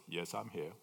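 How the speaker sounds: a hint of a deep, calm God voice. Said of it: "He says".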